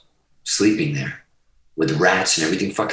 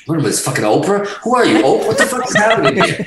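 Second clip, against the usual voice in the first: laughing disbelief; 6 semitones higher, faster than usual. Real, not acted. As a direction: laugh off the tears, incredulous and high-pitched. Jokes at own tearing up.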